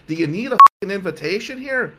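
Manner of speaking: loud, high retold rant; mock-yell in exasperation; exasperated outburst. Exasperated friend spells out situation.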